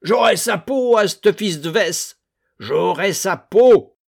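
high, loud furious bellow; bellowing rage. A narrator's reading. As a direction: roar out the vow of revenge. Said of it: "continuait à gueuler". (Doublenerre gueule sa vengeance, furieux)

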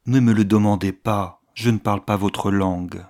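plain reading voice

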